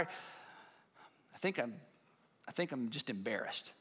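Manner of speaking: a soft, low, hesitant admission; hushed admission. Retold or imitated. Voicing a quiet realization.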